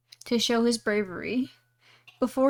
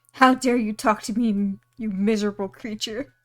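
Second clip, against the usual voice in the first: louder, scornful giant; contemptuous giant; 4 dB louder than usual. Acted. Giant scorning the little tailor, louder. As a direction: sneer down at the tiny man. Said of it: "looked contemptuously at the tailor".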